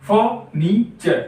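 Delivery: Furniture slowly in three beats, fur, ni, cher; three-part furniture for learners; syllable by syllable. Shown as a demonstration. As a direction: pause after each syllable of furniture. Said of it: "तीन syllable वाला word".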